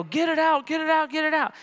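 high, urgent mock-child begging; panicked pleading. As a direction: beg in a rush like a hurt kid. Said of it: "and I'd go".

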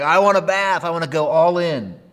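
high and loud, eager; eager Peter. Retold or imitated. Preacher mimics Peter wanting a full bath, eager. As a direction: say it like a kid wanting everything. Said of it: "He's like".

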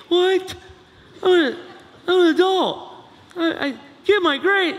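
high, loud outraged whine; student objecting to parent seeing grades; indignant college kid. Acted. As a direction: protest like an offended young adult.

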